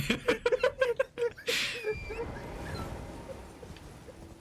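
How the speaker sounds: high, helpless burst of laughter; long laughing fit. Real, not acted. Streamer cracks up at being cut off, helpless laughter. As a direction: crack up, tailing off slowly.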